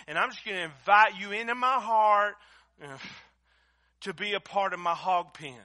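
loud, high, impassioned preacher's cry; fiery preaching. Acted. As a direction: preach it at full force, pleading. Preaching at full force.

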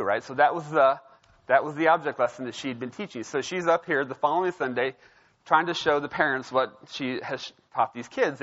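ordinary talk, nothing marked

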